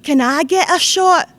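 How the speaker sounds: put-on high, loud child's voice calling Anne in a Scots accent; wee Scottish boy. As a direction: call out like an excited wee Scottish lad tugging at her. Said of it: "I'm going to do it in the Scots dialect".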